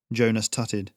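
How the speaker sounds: plain reading voice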